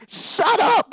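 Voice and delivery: high, loud angry shout; yelled at the screen. Retold or imitated. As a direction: yell at the game character. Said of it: "And I'm like".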